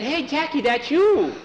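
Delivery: put-on high-pitched excited kid voice; excited child. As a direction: pitch it way up like a thrilled youngster. Excited child tells Jackie of win.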